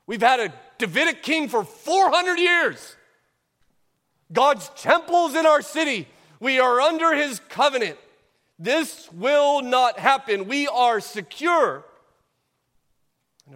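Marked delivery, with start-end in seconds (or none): 0.1-11.8 higher, louder voice of smug, confident Judeans; mock-certain townsfolk, higher and louder. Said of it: "That's what the Judeans said".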